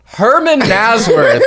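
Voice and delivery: slow, high laughing shout-out of a name; cracking up while praising a friend. Real, not acted. Cheering for Herman.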